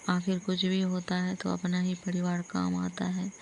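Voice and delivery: ordinary talk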